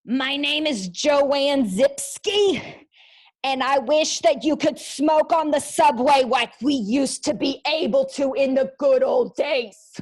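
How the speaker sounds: slow, loud, hammed-up subway smoker character; a bold old-timer grumbling about the good old days. Shown as a demonstration. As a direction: boom out the intro like a nostalgic old smoker. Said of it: "so for example".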